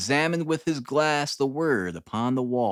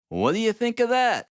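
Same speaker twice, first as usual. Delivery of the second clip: high, louder showman's question; cried out; 9 semitones higher, 4 dB louder than usual. A narrator's reading. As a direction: present it like a showman. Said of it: "cried the detective".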